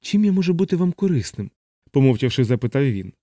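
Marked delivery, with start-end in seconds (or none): 0.0-1.5 high, uneasy offer of help; timid, high-voiced politeness; nervous chaplain. A narrator's reading. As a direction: pitch it up, nervously eager to be useful. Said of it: "Капелан знервовано засувався на стільці".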